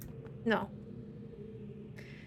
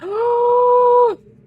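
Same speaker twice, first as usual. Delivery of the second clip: sharp high gasp of surprise; loud gasp; 15 dB louder than usual. Real, not acted. Sudden discovery in puzzle.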